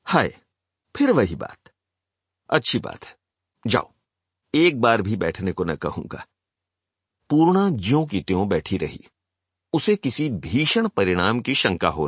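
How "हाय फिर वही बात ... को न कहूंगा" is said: mildly exasperated, in short sighing bursts with pauses; exasperated lament. A narrator's reading. Suitor despairs at Purna wanting to leave, exasperated. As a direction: give up on them with wounded, understated exasperation. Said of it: "कमला प्रसाद ने सिर ठोक कर कहा".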